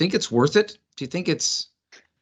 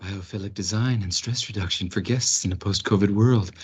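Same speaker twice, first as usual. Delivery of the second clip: spa voice; 4 semitones lower than usual. Shown as a demonstration. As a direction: read it like a spa relaxation ad. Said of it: "that was my attempt at a spa voice".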